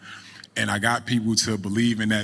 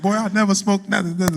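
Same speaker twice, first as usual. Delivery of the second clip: imitating grandma, high-pitched protest; mock indignant denial; 11 semitones higher, 4 dB louder than usual. Grandmother denies smoking to her grandson, mock indignant denial. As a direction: protest your innocence in a high comic voice.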